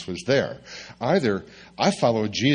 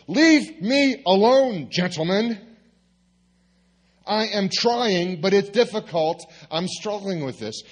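exasperated plea; 11 semitones higher, 5 dB louder than usual. Retold or imitated. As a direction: drag it out high, begging to be left alone. Pastor pleads with friends.

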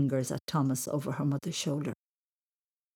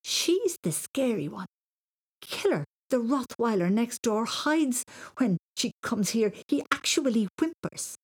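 indignant protest; 6 semitones higher than usual. A narrator's reading. Boy tells mam his cousin scares the dog, indignant protest. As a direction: argue back in high-pitched disbelief.

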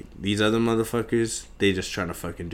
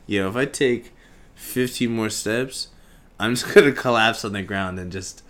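normal talking voice

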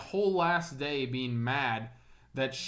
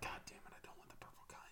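whispered grumble; 23 dB quieter, faster than usual. Acted. Child grumbling over gummy vitamin.

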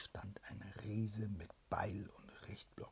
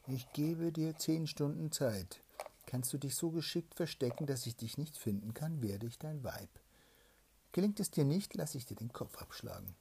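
stern princess; 6 semitones higher, 8 dB louder than usual. Acted. Princess setting the challenge.

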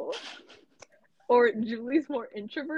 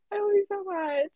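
higher, tearful declaration; teary; 10 semitones higher than usual. Real, not acted. Telling her best friend she loves her, tearfully. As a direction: voice near tears. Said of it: "I'm not gonna get too emotional".

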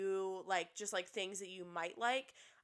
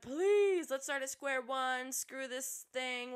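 high, loud begging that eases off; mock pleading; 4 semitones higher, 3 dB louder, slower than usual. Host mimics a woman begging her ex, mock pleading. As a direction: imitate a desperate plea, hammed up.

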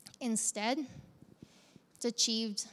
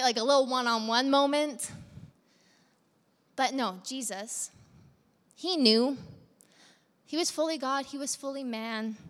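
ordinary talk, nothing marked

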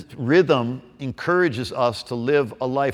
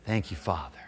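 soft prayer; 7 semitones lower, 13 dB quieter than usual. Shown as a demonstration. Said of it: "Interrupt it with".

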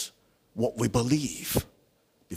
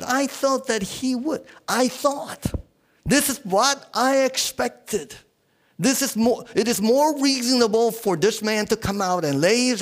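high, loud indignant tirade; angry; 8 semitones higher, 5 dB louder than usual. Acted. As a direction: rant like an affronted man, easing toward the end. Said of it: "Naaman went away angry and said".

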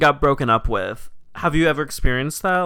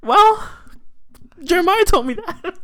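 much higher, smug and teasing; catty roleplay friend; 4 dB louder than usual. Pretend. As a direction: tease her like a smug frenemy. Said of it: "let's roleplay this".

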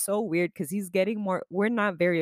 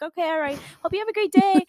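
high, bright sign-off that gets louder; cheery goodbye; 11 semitones higher, 6 dB louder than usual. Retold or imitated. Leaving Lonnie's room after small talk, a high chipper goodbye. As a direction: sing out a chipper farewell.

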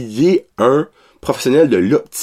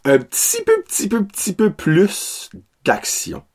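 pleading; 8 semitones higher, 4 dB quieter than usual. Acted. Begging the film for more action.

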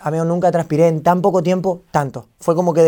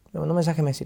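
quiet, stunned retelling; voice of the moment; 8 dB quieter, faster than usual. Retold or imitated. Streamer repeats the news to friend, softly stunned. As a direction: drop the volume, relive the shock. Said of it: "me sale esa voz".

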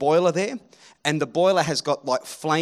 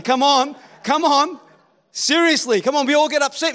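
high, loud pleading call; urging crowd; 9 semitones higher, 6 dB louder than usual. Real, not acted. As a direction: rouse the room. Preacher urging unresponsive congregation.

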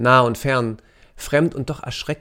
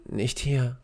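softer, strangled panting words; choked gasp; 6 dB quieter than usual. A narrator's reading. Kaya overwhelmed among the helpers, softer choked gasp. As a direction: gasp it out through a choking throat. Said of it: "keuchte sie mit erstickender Stimme".

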